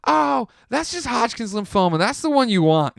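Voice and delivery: high, loud imitation of a tipsy woman; drunk girlfriend. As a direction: blurt it out like a mock drunk friend. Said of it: "she's drunk too".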